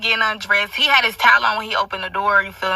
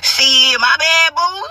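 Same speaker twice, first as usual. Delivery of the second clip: playful apology; 6 semitones higher, much slower than usual. Retold or imitated. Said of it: "He talking about some".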